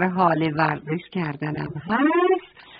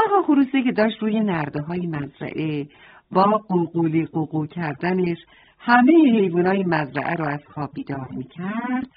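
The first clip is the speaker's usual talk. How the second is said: plain reading voice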